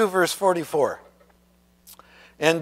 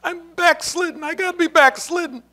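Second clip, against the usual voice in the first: much higher, fretful worried brother; anxious brother; 12 semitones higher than usual. Retold or imitated. Brother fretting to John Wesley that he's backslidden, high and anxious. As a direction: whine it like a man sure he's lost. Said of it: "He goes".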